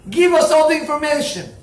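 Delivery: high, loud interrogator's demand; demanding shout. Retold or imitated. Interrogators shouting at the captive Ali Cohen, loud and demanding. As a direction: bark the order at the prisoner.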